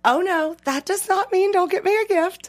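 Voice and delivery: high-pitched mock outrage; indignant. Wife sets husband straight about Valentine's, indignant. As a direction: correct him, scandalized, in a high voice.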